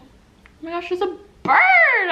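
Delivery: sudden high startled cry, slowing down. Real, not acted. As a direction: jump at the sight of a bird. Bird suddenly appears at window.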